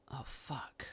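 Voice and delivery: hushed, almost whispered dread; quiet, breath-only curse; intimidated officer. Acted. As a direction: let it slip out softly, cowed.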